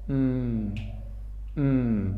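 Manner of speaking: "mm" as a soft, isolated hum; letter M sound. Shown as a demonstration. Teacher showing how letter M sounds, soft hummed "mm". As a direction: hum a short, quiet "mm". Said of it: "It's pronounced like".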